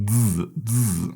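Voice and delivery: "Z, z" as two drawn-out dzz buzzes; buzzing z. Shown as a demonstration. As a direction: buzz each "Z" with no clear vowel. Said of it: "this kind of buzzing with a z".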